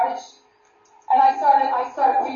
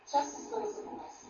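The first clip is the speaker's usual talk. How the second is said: breathy whisper, much softer; 7 semitones higher, 15 dB quieter than usual. Shown as a demonstration. Preacher redoes the line for the girls as a whisper. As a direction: drop it to a hush. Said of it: "That's not it".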